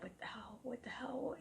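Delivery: hushed, breathless what the hell; shocked whisper. Retold or imitated. Two friends whisper to each other in shock. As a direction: whisper it in disbelief. Stunned friends react to the sister.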